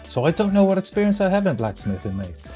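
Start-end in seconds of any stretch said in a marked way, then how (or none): none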